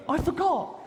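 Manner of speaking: very high, squeaky comic excuse; sheepish defendant. Acted. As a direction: squeal a silly excuse to the judge. Defendant's silly excuse to the judge.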